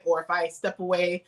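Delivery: ordinary talk, nothing marked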